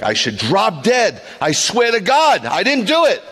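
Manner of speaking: high, loud, slow desperate oath; swearing oath. Retold or imitated. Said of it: "somebody would say".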